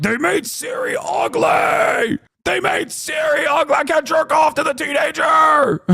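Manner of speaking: high, stretched-out mocking yell; mock whiny gamer outrage. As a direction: parody the angry fans shrieking. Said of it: "these guys are like".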